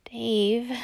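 playful loud rebuke to a cheeky friend; admonishing. Acted. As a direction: chide him, teasing but firm. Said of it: "you admonish".

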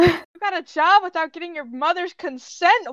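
hammed-up scandalized mom; mock outraged mother. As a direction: scold a rebellious child in mock horror. Playfully voices an outraged mother.

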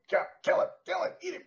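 high, loud devilish urging; little devil voice. Retold or imitated. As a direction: play the shoulder devil egging you on. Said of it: "this little devil on its shoulder, you know, going".

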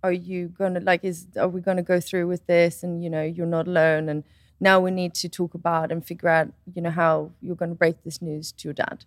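low, soft, measured reassurance; gentle stepmother. Retold or imitated. Stepmother responding to pregnancy news, soft low calm.

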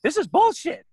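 high-pitched mock protest; imitated indignant ex. Ex protests the traffic stop, high indignant imitation. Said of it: "she was like".

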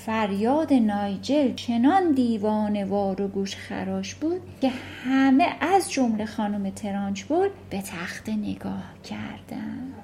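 ordinary talk, nothing marked

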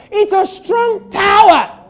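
loud, high, triumphant proclamation; preaching at full force. Real, not acted. Preacher defying the challenger from the strong tower, full-force shout. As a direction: cry it out to a congregation, building to a shout.

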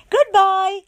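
high, loud cheerful goodbye; cheery Miss Contrary. A narrator's reading. Mother voicing Miss Contrary greeting her guest brightly. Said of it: "said little Miss Contrary".

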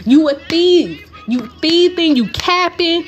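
mock furious call-out; angry accusation. Accusing Drew in the retold fight.

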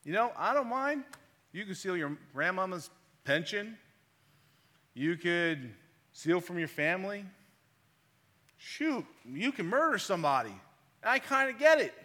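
a hint of a streetwise inmate; imitating a prisoner. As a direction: lightly voice the prisoner shrugging it off. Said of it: "he was like".

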